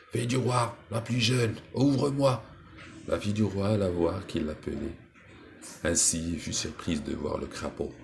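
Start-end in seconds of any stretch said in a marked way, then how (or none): 0.1-2.4 higher, louder call at the door. Acted. Toad calls through the castle door, higher and louder. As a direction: cry out through the door, rising to the demand. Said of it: "on frappa à la porte en criant".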